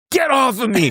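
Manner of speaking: high-pitched mock angry yelp; irritated cry, pitch leaping way up; angry outburst. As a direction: imitate him snapping in sudden anger. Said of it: "and he was mad".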